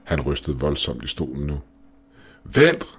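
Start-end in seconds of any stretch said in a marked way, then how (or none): 2.4-2.9 high, loud call to wait; shouted, sudden and high; urgent shout. A narrator's reading. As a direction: cry out to stop them, pitch leaping up. Urgent shout to stop the professor.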